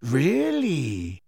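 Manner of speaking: a hint of idle interest; lightly curious Snape; barely interested, cool; faint interest. A narrator's reading. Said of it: "first faint sign of interest".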